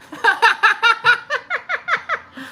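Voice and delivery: squeaky burst of laughter; high laugh. Real, not acted. Laughing at chat.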